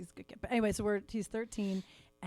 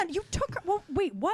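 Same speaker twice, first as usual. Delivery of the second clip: shocked mom; 9 semitones higher than usual. Retold or imitated. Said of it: "and I was like".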